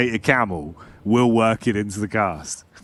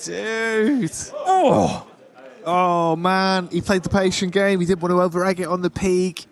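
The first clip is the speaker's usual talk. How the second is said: high, thrilled analysis; excited caster reaction; 8 semitones higher than usual. Acted. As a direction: react hyped to the clutch.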